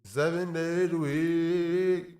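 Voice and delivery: slow, high, warbling fan voice. Real, not acted. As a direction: sing slowly into a spinning fan. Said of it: "Me when I speak into the fan".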